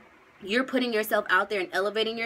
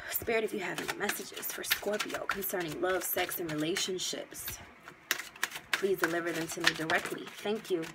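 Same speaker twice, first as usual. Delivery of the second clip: soft prayer to spirit; 3 semitones lower, 8 dB quieter than usual. Real, not acted. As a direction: call on spirit gently and low. Asks spirit for messages.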